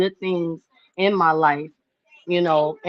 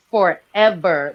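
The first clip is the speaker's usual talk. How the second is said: usual speaking voice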